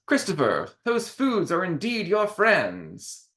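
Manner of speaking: high, slow showman's voice; clown voice. Acted. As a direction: proclaim it like a grand clown. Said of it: "Rococo the clown says".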